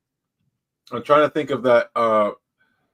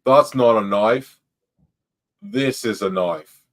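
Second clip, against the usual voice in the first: slow, drawn-out Australian accent; broad Australian accent, loud opening, unhurried; Crocodile Dundee; 4 dB louder, slower than usual. Shown as a demonstration. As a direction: do a punchy Aussie movie-hero line, taking it slowly. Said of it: "I want you to do Crocodile Dundee".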